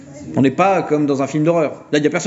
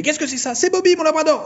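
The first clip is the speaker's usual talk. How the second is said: high excited voice; hammed-up delight about the dog; playful high voice; mock surprised exclamation; 13 semitones higher than usual. Character voiced in parody.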